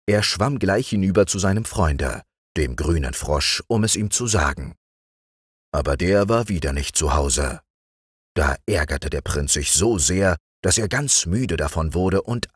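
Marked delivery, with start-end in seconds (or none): none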